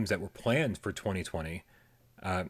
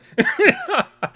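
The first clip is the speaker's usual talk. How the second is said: loud laugh; 11 dB louder than usual. Real, not acted. Laughing during mock rant.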